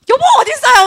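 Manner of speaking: high, loud call across the house; calling wife. Retold or imitated. Preacher voices his wife calling for him, high and loud. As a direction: call out for your husband.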